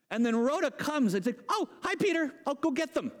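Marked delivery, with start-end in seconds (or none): 1.5-3.1 high, giddy greeting; excited servant girl. Acted. Rhoda to Peter at the door, excited. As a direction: play an overjoyed girl, pitched up. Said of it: "She's so excited".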